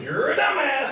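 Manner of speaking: high swooping drive-by yell; shout from passing car. Retold or imitated. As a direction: imitate a yell whizzing past in a car. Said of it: "All I heard when she went by was".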